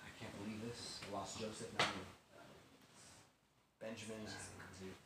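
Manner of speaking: low, quiet resignation; softly mourn, as if beaten down; despairing father. Acted. Jacob faces losing Benjamin, quiet despair.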